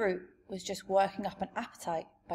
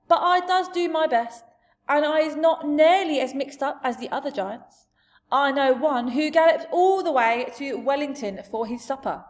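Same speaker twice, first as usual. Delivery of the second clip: loud, raised giant voice; big giant voice, higher and louder; expounding giant; 6 semitones higher, 8 dB louder than usual. Acted. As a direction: hold forth as a blustering giant. Giant defends himself to Sophie.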